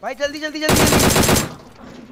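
urgent plea. Real, not acted. Knocked, begging for quick help.

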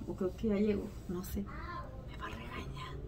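hushed whispered aside. Real, not acted. Wife whispering that her husband will scold her. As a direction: whisper it so he won't hear.

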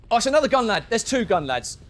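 high loud startled shout; surprised by another gunman; loud dismayed cry; alarmed. Real, not acted. New enemies appear.

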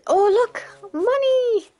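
high excited call; excited shout. Real, not acted. Streamer spotting money, calling it out high and excited. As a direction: point it out with delight. Spots coins again.

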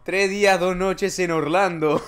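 loud, high, drawn-out prize announcement; slow, hammed-up high shout; mock announcer shout. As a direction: belt it out like a game-show prize. Mock announcement echoing the trip length.

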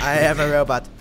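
choppy robot voice from a laggy mic. Pretend. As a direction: speak like a glitchy robot. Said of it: "P C Yeti's robot voice".

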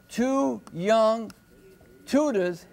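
put-on "two young tutors" slowly measured, each word set apart; scanning the beat. Shown as a demonstration. Teacher marking poetic feet, slow measured "two young tutors".

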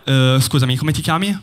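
ordinary talk, nothing marked

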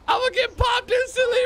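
high panicked laughing cry. Real, not acted. Panicking about being shot.

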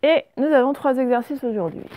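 normal talking voice

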